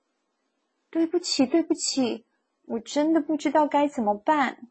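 lightly voiced little creature apologizing; small voice. A narrator's reading. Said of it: "有一个细小的声音从窗边传来".

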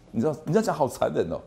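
normal talking voice

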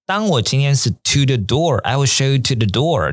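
normal talking voice

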